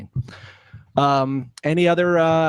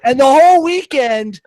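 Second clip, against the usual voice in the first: lightly voiced high, loud Missy; putting on Missy's voice, a touch louder; 12 semitones higher, 7 dB louder than usual. Telling friends about the weekend, in a light Missy voice. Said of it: "I'm doing the Missy voice".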